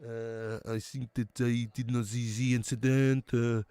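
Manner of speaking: rough French accent impression; Arsene Wenger. Streamer doing a requested manager voice, French accent. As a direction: put on a generic Frenchman voice. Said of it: "Wenger voice".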